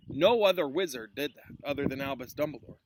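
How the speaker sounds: usual speaking voice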